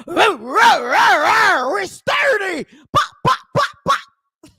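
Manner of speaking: loud growling roar into high chant; hammed-up, punchy shouted rap mockery. YouTuber mocking a rapper interrupting the fun, roaring. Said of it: "And here she go".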